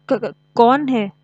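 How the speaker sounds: slow, loud, high stuttering question; frightened stammer. A narrator's reading. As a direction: stammer it out, scared of the dark. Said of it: "लोमड़ी ने डरते-डरते पूछा". (लोमड़ी अंधेरे में डर से हकलाकर पूछती है)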